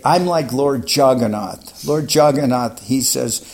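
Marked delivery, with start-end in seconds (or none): 0.0-1.9 playful guru quote. Retold or imitated. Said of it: "he'd say".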